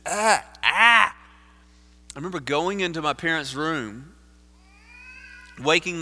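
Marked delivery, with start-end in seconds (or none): 0.1-1.1 two higher, louder cries of dismay; anguished cry. Retold or imitated. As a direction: cry out in guilty distress, acted. Retelling his guilty morning-after reaction.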